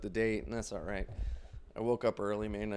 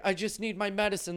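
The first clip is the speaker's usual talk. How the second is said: higher, pitiful plea; fake invalid; 8 semitones higher, 4 dB louder than usual. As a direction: play up the helpless act. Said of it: "I'd be like".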